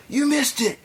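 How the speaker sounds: very high mock-frantic cry; freaking-out man. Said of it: "he's freaking out".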